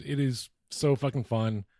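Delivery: usual speaking voice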